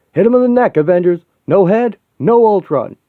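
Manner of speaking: high, loud rallying call; rally the team across the battlefield; battle cry. Acted. Cap rallies the Avengers to hit the robots' necks, shouting.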